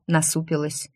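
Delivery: ordinary delivery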